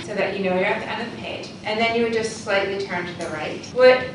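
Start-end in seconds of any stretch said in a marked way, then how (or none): none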